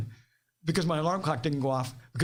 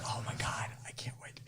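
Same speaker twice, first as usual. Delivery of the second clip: breathless, unvoiced whisper of glee; excited whisper; 12 dB quieter than usual. Coworkers eager for Boots to be caught, whispered. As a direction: hiss it like a secret you can't contain.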